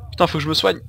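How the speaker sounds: ordinary delivery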